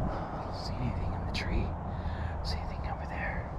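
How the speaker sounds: soft, breathy whispered searching. Real, not acted. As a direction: whisper so nobody hears you. Searching quietly in the woods.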